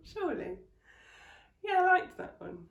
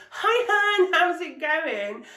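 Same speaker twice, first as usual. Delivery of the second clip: high, loud, slow sugary greeting; loud high drawn-out hi hun; gushing hun voice; 7 semitones higher, 9 dB louder, much slower than usual. Retold or imitated. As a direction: coo it like a fake-friendly seller. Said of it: "a message from a friend saying".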